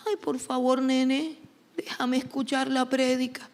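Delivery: pleading mother. Acted. Mother begging her child to let her hear the sermon, pleading. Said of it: "la mamá le dice en un tono de súplica".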